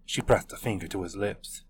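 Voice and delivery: usual speaking voice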